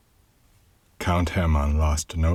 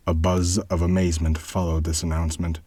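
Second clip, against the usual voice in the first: ordinary delivery, nothing marked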